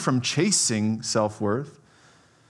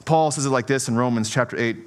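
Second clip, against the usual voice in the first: normal talking voice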